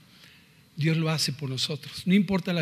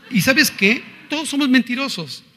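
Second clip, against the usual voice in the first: higher, louder challenge to the crowd; emphatic preaching; 7 semitones higher, 5 dB louder than usual. Real, not acted. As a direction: call them out with force. (predicación enfática)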